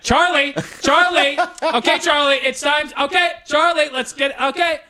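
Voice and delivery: high, anxious grandma calling; panicky grandma. Retold or imitated. Grandma pulling Charlie away from a stranger, high and panicky. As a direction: hurry the child away, high and nervous. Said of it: "Grandma slowly entering the picture".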